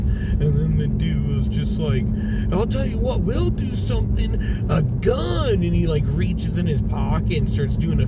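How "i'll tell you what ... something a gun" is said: high-pitched crackhead threatening; imitated threat. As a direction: mimic a maced man's wild bluff. Maced man makes a threat.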